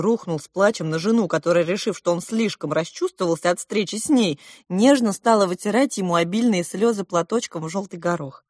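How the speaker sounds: plain reading voice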